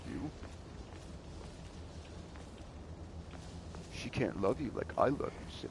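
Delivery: whispered obsessive yandere voice; overacted anime dub. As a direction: breathe it like a creepy stalker. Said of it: "like, yondeer".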